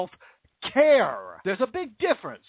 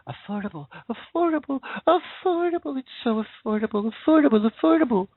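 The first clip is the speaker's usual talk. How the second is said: mock sing-song; 3 semitones higher than usual. Mocking the name Affordable Care Act.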